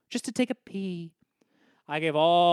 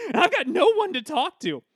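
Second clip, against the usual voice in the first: crying; 7 semitones higher than usual. Acted. Tearful confession.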